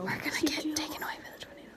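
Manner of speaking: whispering. Pretend. Pretending a tornado, whispering.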